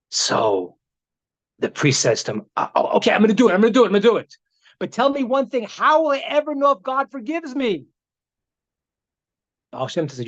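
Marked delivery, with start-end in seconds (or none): 2.6-4.3 flustered priest. Retold or imitated. Panicked priest agreeing. 4.8-7.9 anxious plea. Retold or imitated. Priest anxiously asking.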